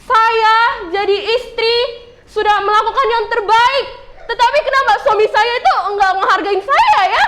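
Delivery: high, hammed-up wifely rant; mock yelling. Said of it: "tiba-tiba ibunya teriak-teriak".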